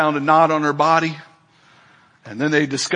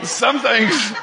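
laughing call-out; 7 semitones higher than usual. Real, not acted.

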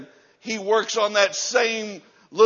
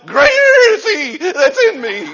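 high, loud, cracking with laughter; cried-out scripture; 14 semitones higher, 11 dB louder than usual. Shown as a demonstration. Scared believer quoting to himself, shouting high. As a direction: cry it out through a laugh. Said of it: "you can quote to yourself".

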